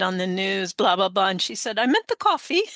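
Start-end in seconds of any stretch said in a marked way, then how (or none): 1.8-2.6 put-on dry British accent, much higher; a wry British-accented correction. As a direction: deliver the punchline in her British voice. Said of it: "She's, she's British, British accent".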